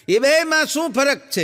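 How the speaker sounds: teacher's question. Retold or imitated. Teacher asking.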